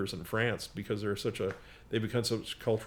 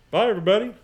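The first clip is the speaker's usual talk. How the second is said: cheery loud goodbye; 10 semitones higher, 8 dB louder, much faster than usual. Real, not acted. Signing off the podcast.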